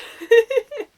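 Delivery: bursting out laughing; big high laugh; loud laugh. Real, not acted. Laughs at own joke.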